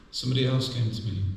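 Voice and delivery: ordinary talk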